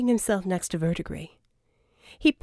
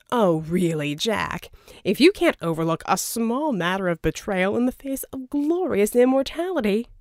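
louder, smooth persuasive schemer; a charming villain's pitch, louder; Verdigris voiced; 4 dB louder than usual. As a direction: sell the deal, oily and grand. Verdigris brushing off betrayal.

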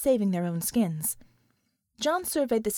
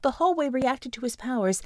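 ordinary delivery

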